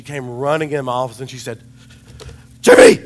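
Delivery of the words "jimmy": loud high call of his name; shouted name. Retold or imitated. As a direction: yell it like she ran in scared. Coworker bursting in calling his name.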